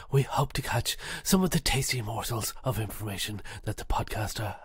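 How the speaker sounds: hushed wildlife documentary narrator; nature documentary. Pretend. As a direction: narrate like a nature show, half whispered. Mock nature documentary intro.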